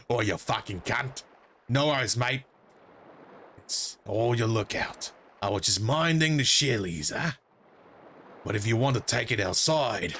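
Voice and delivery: broad Aussie tough-guy voice; Australian dolphin. Acted. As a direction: pick a fight in an Australian accent. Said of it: "is this dolphin Australian?".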